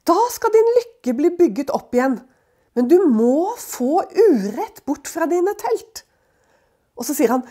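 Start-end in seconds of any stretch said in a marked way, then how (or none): 0.1-6.1 Elifas rebuking. Acted. Quotes Elifas.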